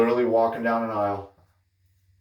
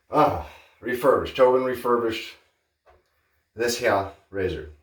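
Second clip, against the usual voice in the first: Doc Holliday; 4 semitones higher than usual. Acted. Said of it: "I did Doc Holliday for a second".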